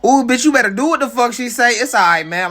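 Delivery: high, loud, thrilled ooh; loud excited squeal at the screen; hyped reaction. Real, not acted. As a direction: cheer her on like a fan. Reacting hyped to Storm's command.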